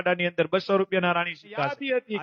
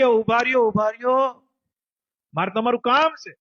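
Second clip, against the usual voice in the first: raised voice, calling after someone; 6 semitones higher, 4 dB louder than usual. Acted. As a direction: call out to stop the rider. Boy calls out to stop Maharaj. (બૂમ પાડીને રોકતો)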